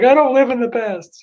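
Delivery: high, mock-indignant protest. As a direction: pitch it up high, playfully defensive. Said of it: "I'm like".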